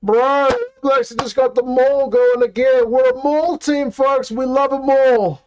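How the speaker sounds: high, loud cheering for the maul; excited shouting. Real, not acted. Fan yelling at the screen as his team's maul rolls forward, thrilled and loud. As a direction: hype up the forward drive like a fan. Maul drive during live match.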